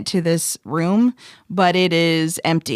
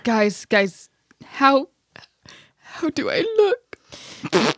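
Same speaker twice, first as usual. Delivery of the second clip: high, slow crying voice; whimpering through tears; pained whimper; 5 semitones higher, slower than usual. Acted. Battered character asks.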